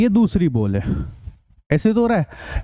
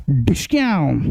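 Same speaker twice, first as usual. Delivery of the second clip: playful spoken gunshot sound, lower; mock gunshot; 5 semitones lower, slower than usual. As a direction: say "डिशक्याम" like a toy gun firing. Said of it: "देखो मैं आपको चला के दिखाता हूँ".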